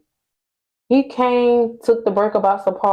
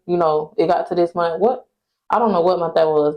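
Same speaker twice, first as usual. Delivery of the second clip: usual speaking voice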